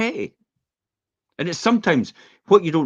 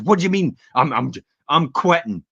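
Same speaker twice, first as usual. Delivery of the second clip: higher, louder mock outburst; snapping at the boss; 7 semitones higher, 6 dB louder than usual. Man snapping at his boss and quitting, loud and high. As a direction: blow up and quit on the spot. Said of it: "He goes".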